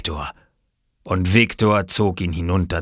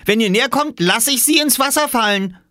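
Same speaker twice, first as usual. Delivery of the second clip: very high, raised threat; calling out; 5 dB louder than usual. A narrator's reading. Small boy keeping swimmers at bay, high threatening call. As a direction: warn them off in a high child's shout. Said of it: "rief Oskar".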